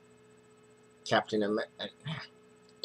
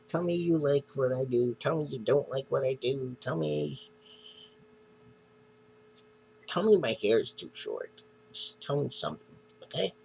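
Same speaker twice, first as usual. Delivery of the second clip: playful begging; 6 semitones higher than usual. Real, not acted. Begging viewers for comments.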